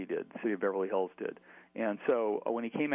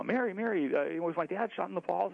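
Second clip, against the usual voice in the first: put-on high-pitched, anxious kid asking; anxious kid question; 7 semitones higher than usual. Boy anxiously asks housekeeper Mary, high and urgent.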